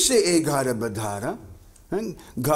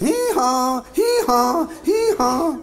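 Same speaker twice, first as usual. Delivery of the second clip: donkey bray; 9 semitones higher, slower than usual. Shown as a demonstration. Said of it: "You ever heard a donkey sing?".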